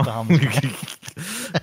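mock innocent. Mock defending the prank.

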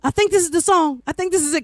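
high, eager, half-sure; suddenly recognising her own song; excited realisation. Retold or imitated. Retold excited realisation on air.

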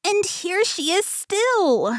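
very high, slow little bear squeal; delighted shrill baby bear; joyful baby bear squeak. A narrator's reading. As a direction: squeak it out with joy. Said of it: "squeaked the baby bear joyfully".

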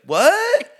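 very high, loud clueless what; Dylan, clueless, squeaks a confused what; dumb confused soldier. Pretend. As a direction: squeak it out, totally lost. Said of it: "is gonna be like".

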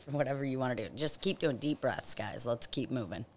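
very low, very soft guidance; hushed breathing coach. Real, not acted. Host keeping everyone breathing through the minute, hushed and low. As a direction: murmur low encouragement to the group.

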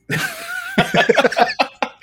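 sudden high-pitched laugh; high burst of laughter. Real, not acted. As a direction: break into a squealing laugh. Laughing at own joke.

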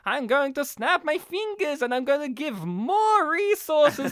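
high, emphatic cartoon villain; Doctor Doofenshmirtz. Villain as Thanos explaining his plan, high proclaiming voice. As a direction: proclaim it as a mad scientist. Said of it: "and he's just like".

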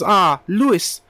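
put-on playful German doctor accent. Therapist greeting his patient Louis, German accent. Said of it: "He, he talks like this".

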